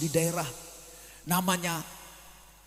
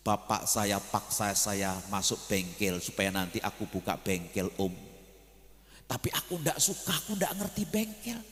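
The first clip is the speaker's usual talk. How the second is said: sulky student; 9 semitones lower, 8 dB quieter than usual. Retold or imitated. Student complaining about his father.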